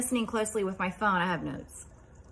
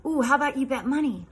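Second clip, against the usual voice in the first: high, quick, eager suggestion; playful excitement; 6 semitones higher, 4 dB louder, faster than usual. Retold or imitated. To a little boy playing a game, playfully excited. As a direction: pitch it up as a fun dare.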